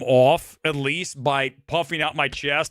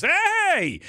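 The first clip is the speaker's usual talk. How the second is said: calling out. Retold or imitated. Guy calls out to him.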